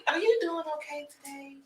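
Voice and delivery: soft, high, cooing question; tender high-pitched coo. Real, not acted. Baby talk to visiting infant.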